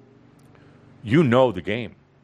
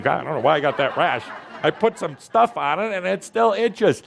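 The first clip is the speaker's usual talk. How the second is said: higher-pitched whiny complaint with a laugh; mock complaint; 6 semitones higher than usual. Teacher mocking a student fretting over a rash, high whiny complaint. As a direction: poke fun at the self-doubter, hammed up.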